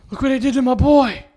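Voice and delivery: loud, high, put-on anguished film line; hammed-up movie lament; movie quote impression. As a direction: cry it out like a melodramatic film father. Film quote for a dead actor.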